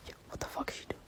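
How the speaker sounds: hushed classmate whisper. Retold or imitated. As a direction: whisper it behind her back. Classmates whispering about her.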